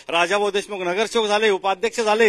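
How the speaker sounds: normal talking voice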